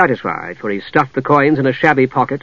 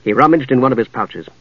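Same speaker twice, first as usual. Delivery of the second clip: ordinary delivery, nothing marked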